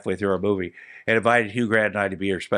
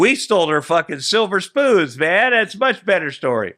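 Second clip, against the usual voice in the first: gleeful boast; 7 semitones higher, 3 dB louder than usual. Retold or imitated. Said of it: "He's like".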